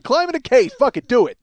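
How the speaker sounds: loud, high-pitched urging; insistent goading. Acted. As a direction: push a friend to just go for it. Acts out goading Pat.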